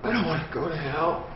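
ordinary talk

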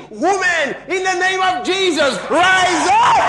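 high, loud command to rise; booming healing command, high and loud; commanding shout. Retold or imitated. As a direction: call her up with full force. Quoting the pastor's healing command.

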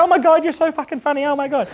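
put-on very high, squealed girly voice; gushing girl impression. Speed-dating girl to the coach, high mock gushing. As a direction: imitate a giggly girl squealing. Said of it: "And the girl's like".

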